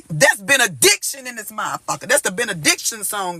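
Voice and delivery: ordinary delivery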